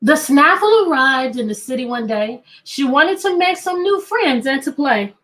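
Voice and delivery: a light storybook reading voice; reading to children. Acted. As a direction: read it like a picture book for kids. Reads the picture book aloud.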